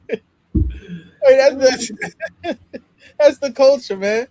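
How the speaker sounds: words squeezed out through laughter; laughing while talking. Real, not acted. Laughing at dating culture.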